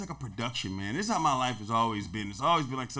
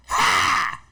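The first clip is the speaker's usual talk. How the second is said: high shouted funk yelp; 4 dB louder than usual. Retold or imitated.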